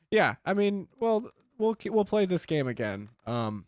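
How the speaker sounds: usual speaking voice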